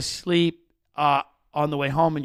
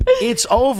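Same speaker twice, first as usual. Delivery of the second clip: much higher and louder, sing-song call; mock announcement; 7 dB louder than usual. Real, not acted. Taunting the celebrity ladies, loud high mock call. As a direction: belt it out like a taunting herald.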